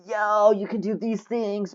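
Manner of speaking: high, drawn-out mock yell. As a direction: imitate viewers calling out, without real volume. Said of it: "might be yelling".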